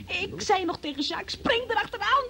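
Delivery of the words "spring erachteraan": urgent call. Retold or imitated. Retold urgent call to Jacques.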